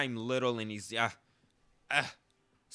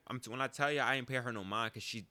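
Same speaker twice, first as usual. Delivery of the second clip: ordinary talk, nothing marked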